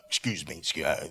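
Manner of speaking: very low, softened apology; acted parent excusing themselves. Parent leaving for a crying baby, low and apologetic. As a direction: mumble a polite excuse while squeezing past. Acting parent hurrying out of room.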